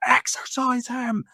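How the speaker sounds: high, drawn-out yelled command; re-enacted war cry, high and stretched; retold battle scream. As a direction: scream the order as if across a field. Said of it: "and just scream".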